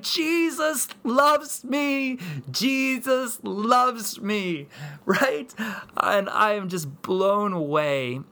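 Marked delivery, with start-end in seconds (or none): 0.0-4.7 high, long-drawn joyful cry; excited child. Retold or imitated. Daughter reads the balloon's message, excited. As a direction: imitate a girl proclaiming it, stretching every word. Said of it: "she's so excited".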